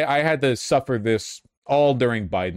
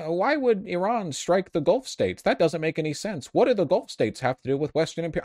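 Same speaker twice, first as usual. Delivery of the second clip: mocking Jon Stewart; 8 semitones higher than usual. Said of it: "the fucking Stephen ... Jon Stewart".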